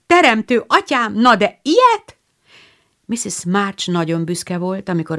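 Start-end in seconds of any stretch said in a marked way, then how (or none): 0.1-2.2 higher, louder astonished shout. A narrator's reading. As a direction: call it out loud in amazement. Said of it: "hangosan kiabálta".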